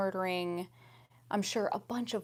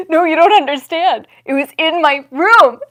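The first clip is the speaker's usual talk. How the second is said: much louder, higher outburst; loud exclaim; 8 semitones higher, 19 dB louder than usual. Real, not acted. Telling viewers loudly the spider was in her room. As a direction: shout it in disbelief. Panicked retelling.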